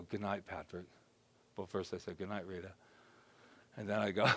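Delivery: soft, hushed bedtime words; tender goodnight. Shown as a demonstration. Speaker wishing himself goodnight, soft and loving. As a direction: say it like tucking in a child. Bedtime self-talk demo.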